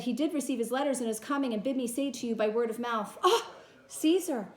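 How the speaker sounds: the servant breaks down at the body; crying servant. Acted. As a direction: voice breaking into a sob on Caesar. Servant weeps seeing Caesar dead.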